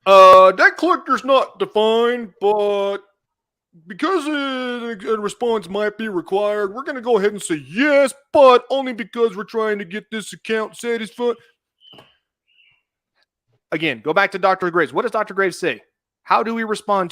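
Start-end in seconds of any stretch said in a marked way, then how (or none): 0.0-11.4 mocking read. Mocking the attorney's written answer.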